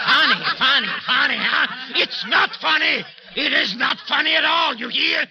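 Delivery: loud, high, furious retort; angry shout. Acted. Man furious at bystanders laughing at the cat. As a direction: bite back at their laughter.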